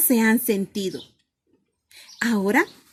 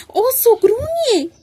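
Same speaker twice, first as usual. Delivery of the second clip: higher, louder, climbing storytelling; building excitement; 7 semitones higher, 6 dB louder than usual. A narrator's reading. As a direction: build up as the bear roars awake. Bear wakes, growls, roars.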